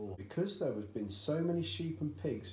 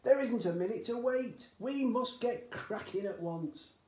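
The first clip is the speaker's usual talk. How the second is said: impatient dad; 12 semitones higher, 6 dB louder than usual. A narrator's reading. Father refusing to wait for his son, high and loud. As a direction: sweep the objection aside.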